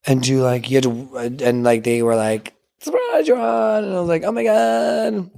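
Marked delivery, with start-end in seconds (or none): 2.8-3.8 high, drawn-out mock announcement; retold squeal of good news; excited announcement. Retelling the phone call news. 4.2-5.3 high, stretched-out squeal; imitated thrilled reaction; retold excitement. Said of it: "I got all excited".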